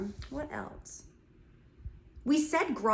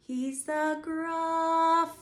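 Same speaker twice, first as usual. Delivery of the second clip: Gruffalo sung higher and long; slow higher sung line; pitch getting higher; 9 semitones higher, much slower than usual. Shown as a demonstration. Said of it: "our pitch is getting higher".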